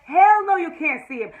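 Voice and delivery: high, loud hell no; mock refusal. [name] refusing the father, a loud sassy hell no. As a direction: throw it back at him.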